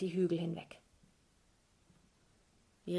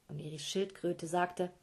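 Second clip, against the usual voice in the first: plain reading voice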